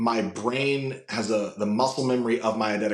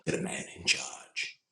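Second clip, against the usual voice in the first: low, hushed arms-dealer villain; mostly whispered, halting menace; Ulysses Klaue; 7 semitones lower, 6 dB quieter than usual. Acted. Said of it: "Ulysses Klaue from Avengers two".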